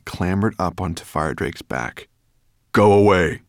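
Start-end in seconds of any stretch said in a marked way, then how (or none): 2.7-3.4 loud firm order; commanding. Acted. Dragon ordering the two men out of the cellar, loud. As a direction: send the men off with authority. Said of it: "the dragon commanded".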